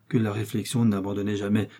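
plain reading voice

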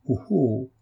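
a mild cry of sudden satisfaction. A narrator's reading. Holmes absorbed in the letter, crying out with satisfaction. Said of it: "poussa tout à coup un cri de satisfaction".

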